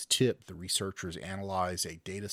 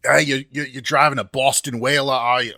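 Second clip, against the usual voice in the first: put-on loud, higher Boston accent; 6 semitones higher, 15 dB louder than usual. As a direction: shout it like an angry Boston local. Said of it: "that accent got weird and out of hand".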